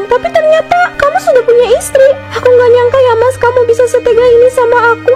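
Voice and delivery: tearful fury at the lying man; angry betrayed woman. Acted. Mistress throwing out the lying husband, furious. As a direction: cry out in hurt anger.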